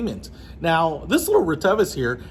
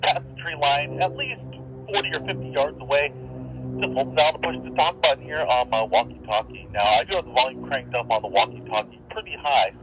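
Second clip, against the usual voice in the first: tinny radio transmission from across the field; talking through a handheld radio, wind behind; walkie-talkie audio; over a walkie-talkie; 3 dB quieter than usual. Real, not acted.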